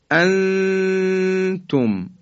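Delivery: "انتم" with the noon held long as a high hidden hum; ikhfa. Shown as a demonstration. Teacher shows noon before ta, long nasalised ikhfa.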